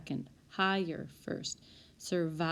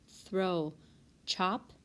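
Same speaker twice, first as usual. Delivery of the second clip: ordinary talk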